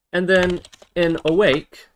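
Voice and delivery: ordinary talk, nothing marked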